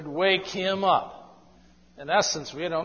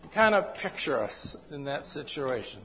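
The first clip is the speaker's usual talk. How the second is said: usual speaking voice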